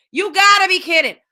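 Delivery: loud, high, disbelieving outburst; exasperated shout. Real, not acted. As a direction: blurt it out in outrage. Outraged rant climax.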